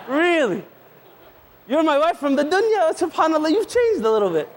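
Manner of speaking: hammed-up wonder at his made-over wife; husband gushing in disbelief at his wife; amazed husband. Husband amazed at wife's makeover.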